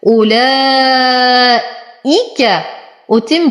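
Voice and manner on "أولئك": أولئك with the madd held six beats; long madd demo. Shown as a demonstration. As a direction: stretch the madd in أولئك full length. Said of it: "يمد بالإشباع ست حركات". (مد متصل مشبع ست حركات)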